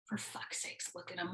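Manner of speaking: whispered, under the breath; exasperated whisper. Real, not acted. Watching the tiny guy walk off, whispering in exasperation. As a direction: hiss it in disbelief.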